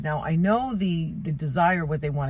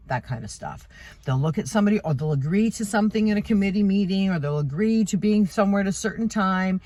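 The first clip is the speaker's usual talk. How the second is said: ordinary talk, nothing marked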